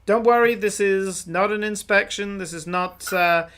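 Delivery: a self-important soldier greeting travellers; puffed-up guard welcoming travellers; officious guard. Acted. GM voices guard NPC.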